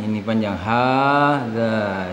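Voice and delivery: slow ini panjang hadza, stretching the long a; teacher stretching the vowel in hadza; long vowel demo. Shown as a demonstration. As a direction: draw out the a in hadza.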